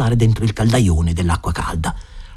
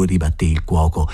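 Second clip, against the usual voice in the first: ordinary delivery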